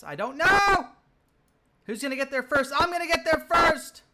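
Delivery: real thrill of the race, shouting at the screen; excited shout. Real, not acted. Racing an enemy in the game.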